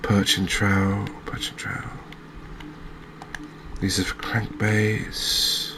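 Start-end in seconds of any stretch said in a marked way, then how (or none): 1.2-1.9 much softer, voiceless murmur; hushed echo. Real, not acted. Angler repeating the bait name to himself, hushed. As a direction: repeat it under your breath.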